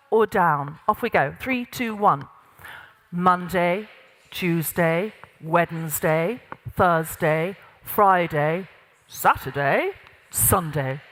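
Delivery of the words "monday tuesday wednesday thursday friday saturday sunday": low, measured gravitas voice, day by day; lowered pitch. Shown as a demonstration. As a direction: count off the days low and firmly grounded. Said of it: "pitch of your voice went down a little bit".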